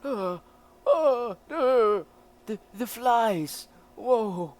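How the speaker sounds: high, loud frightened stammer; a shrieking, scared voice; panicked. Acted. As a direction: stammer in terror at the flies. Character panics, cry heard.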